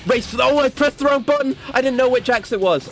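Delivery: real panic over the wrong button; panicked outburst. Real, not acted. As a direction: blurt it out in a sudden panic. Presses the wrong button mid-fight.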